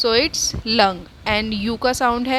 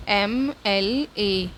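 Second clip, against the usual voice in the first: slow spelling; much slower than usual. Shown as a demonstration. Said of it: "spelling letters for class".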